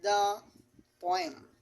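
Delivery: ordinary talk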